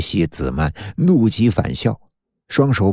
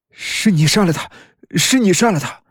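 high, desperate shouted accusation; breakdown scream; 9 semitones higher than usual. Acted. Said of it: "崩溃地喊道".

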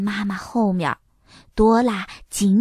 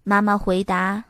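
plain reading voice

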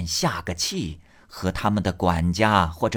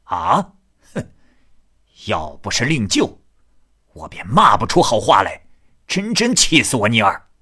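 furious; 2 semitones higher, 7 dB louder than usual. Acted. Ni Er hears how the uncle treated Jia Yun and explodes, loud and furious. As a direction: explode with rage on his friend's behalf. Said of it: "倪二听了大怒".

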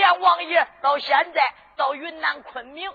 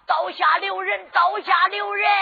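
rising urgent shout, louder on the second; shouting to stop; 5 semitones higher, 3 dB louder than usual. Acted. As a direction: yell to halt the execution, higher each time. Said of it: "就喊道". (高声急喊)

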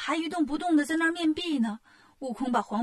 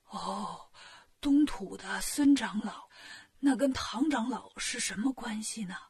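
ailing king, puzzled; 3 semitones lower, 4 dB quieter than usual. Acted. Sick king asks the monk about Elder Sun, feebly puzzled. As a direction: wonder aloud as a feeble, bedridden king.

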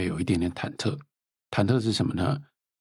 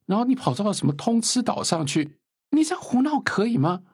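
high, reproachful questioning voice, pitched way up; mock scolding reader; 14 semitones higher than usual. A reader reproaching Wei Xiaobao, in a mock scolding high voice. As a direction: play a shocked reader scolding Wei Xiaobao for fooling around. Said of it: "他也觉得说".

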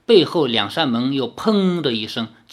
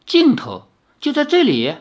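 high, rising disbelief; can't believe it's the end; startled young hero; surprised; 11 semitones higher, 3 dB louder than usual. Acted.

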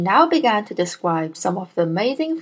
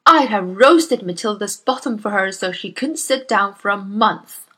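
loud, booming bully of a headmistress; Miss Trunchbull; 5 dB louder than usual. Acted. Bullying headmistress scolds Miss Honey, booming and loud. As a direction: bark at the timid teacher like a tyrant.

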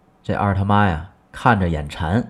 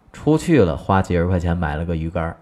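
usual speaking voice

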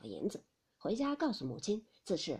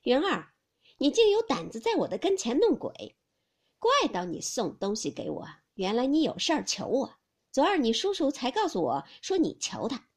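teasing Xifeng; 3 semitones higher, 5 dB louder than usual. Acted. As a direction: tease him from the carriage window. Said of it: "隔窗子笑道". (凤姐笑着数落)

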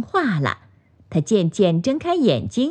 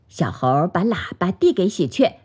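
usual speaking voice

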